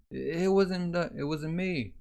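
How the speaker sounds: high-pitched stammered protest; flustered denial. Acted. Accused man denying the killing to guards, flustered.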